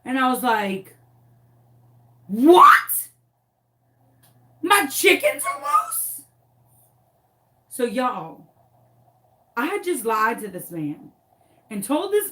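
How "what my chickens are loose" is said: loud high shriek of shock; alarmed disbelief. Retold or imitated. Owner hearing her chickens are loose, shrieking in alarm. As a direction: squawk it in high-pitched disbelief. Alarmed reaction acted in retelling.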